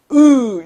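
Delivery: 으 pushed out hard and held; a long, forceful 으. Shown as a demonstration. Teacher guessing the old pronunciation for students, forceful drawn-out 으. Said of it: "이응을 힘있게".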